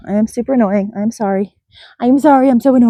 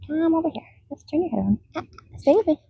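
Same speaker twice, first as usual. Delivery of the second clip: usual speaking voice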